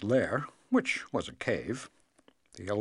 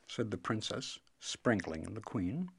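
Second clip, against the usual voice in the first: ordinary delivery, nothing marked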